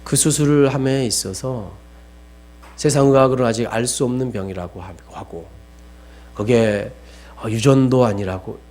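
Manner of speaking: ordinary talk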